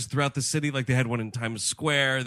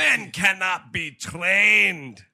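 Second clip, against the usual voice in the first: slow, loud, high shouted rant; ranting TV host; 6 semitones higher, 5 dB louder, much slower than usual. Retold or imitated. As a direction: bellow it like a blowhard host. Imitating the TV host.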